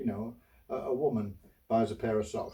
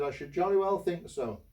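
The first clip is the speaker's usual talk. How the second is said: haughty customer; 7 semitones higher than usual. Retold or imitated. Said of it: "I said".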